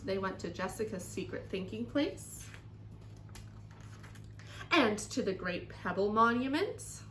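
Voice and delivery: put-on lively, expressive children's story reading; story read-aloud. A narrator's reading. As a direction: read to children with strong emphasis.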